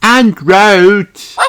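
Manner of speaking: high, loud barked little dog voice; barking puppy voice. Acted. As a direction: bark it out like a puppy. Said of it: "barks Danny Dog".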